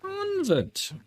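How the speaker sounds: "Convent." squeaked very high then falling; mock squeaky convent. Real, not acted. Streamer mocking her own repeated word in a squeaky voice. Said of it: "why do I keep saying convent?".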